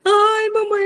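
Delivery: high-pitched imitated little-kid ouch; child's pained whine. Mom imitating her daughter touching the hot iron, pained whine. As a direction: mimic a toddler crying out to mommy. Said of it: "Aí ela tirou".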